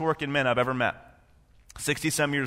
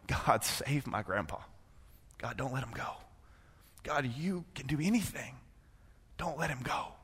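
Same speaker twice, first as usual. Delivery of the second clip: hushed desperate prayer; 10 dB quieter than usual. Retold or imitated. Grandson praying for dying grandpa, softly pleading. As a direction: beg quietly, barely holding on.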